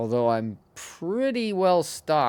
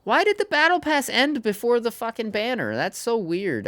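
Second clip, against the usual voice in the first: shocked disbelief; 12 semitones higher than usual. Retold or imitated. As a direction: squeal it in disbelief. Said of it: "I was like".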